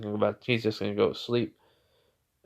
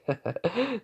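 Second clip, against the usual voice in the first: high, soft giggle; acted laugh; 10 semitones higher, 5 dB quieter than usual. Naruto after teasing the blushing girl, acted giggle. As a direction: laugh in character. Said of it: "he just starts laughing".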